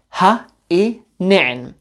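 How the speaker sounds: Ha i ne'en said slowly, each syllable drawn out; slow careful demo. Shown as a demonstration. Teacher models Ha i ne'en slowly for students. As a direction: spell out Ha i ne'en slowly for learners. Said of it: "learn what is in front of you".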